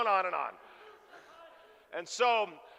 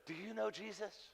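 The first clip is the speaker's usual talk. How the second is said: much softer, leaning-in question; hushed question; 13 dB quieter than usual. Retold or imitated. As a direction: ask it close and hushed. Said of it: "She leans in".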